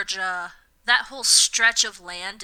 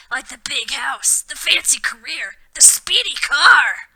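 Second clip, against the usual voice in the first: hammed-up Hagrid; 2 semitones higher, 7 dB louder than usual. Hagrid fretting about Harry's aunt, loud and hammed-up. Hagrid's concerned line voiced.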